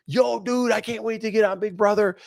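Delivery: imitating a pumped-up dude, high pitched; high-pitched bro excitement; fired up. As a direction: play the hyped contestant, voice way up. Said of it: "He comes in fired up".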